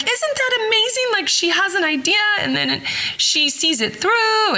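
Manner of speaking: high, gushing mock admiration; imitating Rhonda gushing. Friend Rhonda praising the narrator, high gushing imitation. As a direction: gush like an impressed friend.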